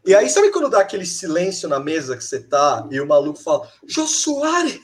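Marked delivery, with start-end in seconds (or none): 3.9-4.8 retold shout. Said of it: "O cara fala a frase mó alto".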